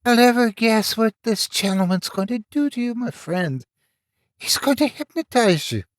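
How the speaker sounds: high breathy villain whisper; whispered falsetto. Acted. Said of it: "he whispered".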